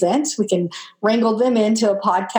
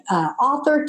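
ordinary delivery, nothing marked

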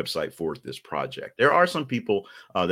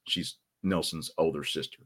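usual speaking voice

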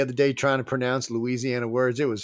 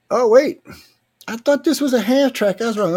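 much higher, louder oh wait; sudden surprise; 11 semitones higher, 6 dB louder than usual. Real, not acted. Host spotting wrong clip, surprised and loud. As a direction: catch your own mistake with a yelp.

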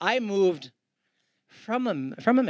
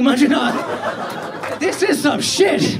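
laughing exasperation; 4 semitones higher, 4 dB louder, much slower than usual. Retold or imitated. Exasperated with the caller.

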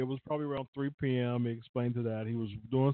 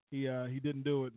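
ordinary talk

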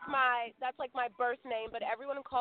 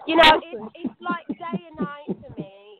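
put-on British accent; 6 dB louder than usual. Guest shows she can switch to a British accent. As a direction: slip back into a posh British accent. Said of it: "let me go ahead and jump right back into it".

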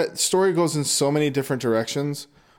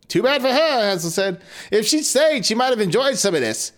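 put-on gloating boy; 8 semitones higher than usual. Hansel gloating, high voice.

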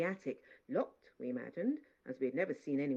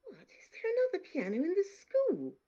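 much higher astonished question; amazed; 6 dB louder than usual. Acted. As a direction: sound truly amazed. Girl amazed.

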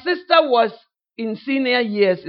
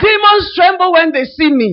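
higher and louder, a hammed-up proud proclamation; mock preacher's boast; 7 semitones higher, 8 dB louder than usual. Preacher imitated boasting to a new sister, loud and high. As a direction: shout it like an over-zealous preacher. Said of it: "he started to say".

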